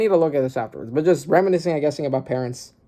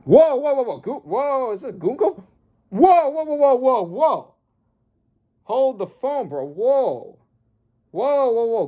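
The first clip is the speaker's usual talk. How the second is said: stunned whoa; 11 semitones higher than usual. Real, not acted. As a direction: pile up the whoas in shock. Stunned by a reveal.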